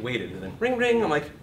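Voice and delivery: ordinary talk, nothing marked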